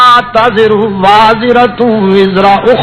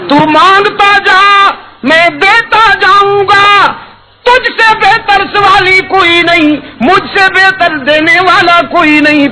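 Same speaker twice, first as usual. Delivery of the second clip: high tender calling voice; voice of God; 10 semitones higher than usual. Acted. God asking the weeping Prophet why he cries, tender. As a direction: call out lovingly and high. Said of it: "رب العالمین فرمائیں گے".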